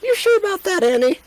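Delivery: very high-pitched teasing query; sceptical Beth. Acted. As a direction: squeak out a doubtful question. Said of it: "Beth looks at you and goes".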